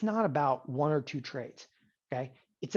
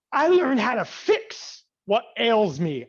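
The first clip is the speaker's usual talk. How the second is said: smug new student; 5 semitones higher, 6 dB louder, much slower than usual. Retold or imitated. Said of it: "Took another class and you go".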